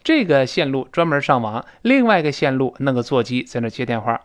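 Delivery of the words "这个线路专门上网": ordinary delivery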